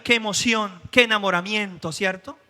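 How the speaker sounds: ordinary talk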